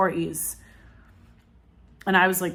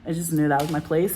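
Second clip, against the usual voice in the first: normal talking voice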